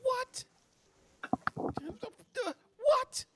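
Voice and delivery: very high falsetto "what"; incredulous yelp. Acted. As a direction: yelp it in disbelief. [name] reacting to psychic claim.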